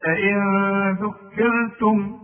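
أئن ذكرتم stretched slowly, nun hidden in a nasal hum; إخفاء بغنة; slow tajweed recitation. Shown as a demonstration. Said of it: "شوف".

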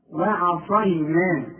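وعصينا very slow, thin "wa-'a" before the heavy s; slow syllable-by-syllable وعصينا; light ayn. Shown as a demonstration. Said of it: "العين".

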